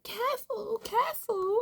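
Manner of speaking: very high worried careful; anxious warning. Retold or imitated. Family fretting as she shoves him uphill, very high. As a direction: fret like a nervous relative. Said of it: "They're all like".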